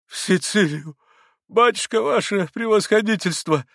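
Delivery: high-pitched helpless exclamation; distraught, climbing high cry; lost, flustered. A narrator's reading. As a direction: plead in utter confusion. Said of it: "потерялся штабс-капитан".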